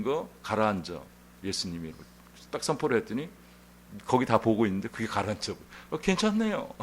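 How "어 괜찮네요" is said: surprised relief. Retold or imitated. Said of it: "뭐 이렇게 하는 거예요".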